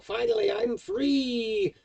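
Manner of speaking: high, drawn-out cry of release; triumphant cry. Acted. Game master voices freed character outside the stones, crying out. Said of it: "he cries out".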